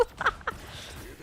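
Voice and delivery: airy giggle; high breathy laugh. Real, not acted. Laughing at joke.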